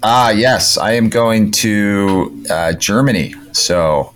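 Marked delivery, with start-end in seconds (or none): none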